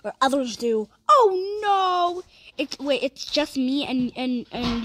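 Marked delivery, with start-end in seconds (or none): 1.1-2.2 high, loud, drawn-out cry; dismayed. Real, not acted. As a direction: wail in sudden dismay. Dismay at musical chairs.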